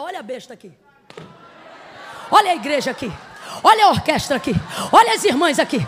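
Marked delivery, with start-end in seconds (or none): 2.3-5.8 loud, driving preacher's cry; fervent revival preaching, loud and rising; full-force preaching. Acted. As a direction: preach it out to the whole hall, building.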